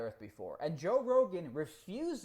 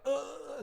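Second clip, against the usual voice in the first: high, drawn-out groan; mock stumped groan; 10 semitones higher than usual. Average person asked for proof, high mock groan. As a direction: groan like a brain jamming. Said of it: "they're gonna be like".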